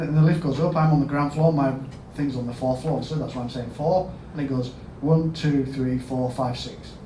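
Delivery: ordinary delivery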